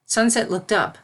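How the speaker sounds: ordinary delivery, nothing marked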